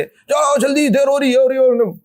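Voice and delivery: high-pitched, fretful rushing; mock hurried nagging. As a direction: imitate a flustered relative hurrying someone along. Mimics fretting relatives hurrying the bride. (घबराई हुई, ऊँची आवाज़ में जल्दी मचाने की नकल)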